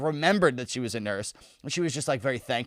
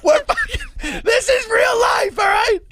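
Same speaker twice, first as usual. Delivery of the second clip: much higher, slow, hammed-up ranting shout; mock conspiracy rant; 5 dB louder, slower than usual. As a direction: play a raving uncle at dinner. Mock political ranting at table.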